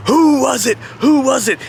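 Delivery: mock frantic demand; Jeff Jarrett. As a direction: imitate an accuser hunting the culprit, pitched high. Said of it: "he's running up and down the aisles".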